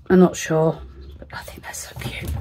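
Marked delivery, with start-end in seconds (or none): 1.2-2.3 whispering. Real, not acted. Whispered aside while crafting.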